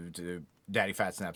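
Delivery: ordinary talk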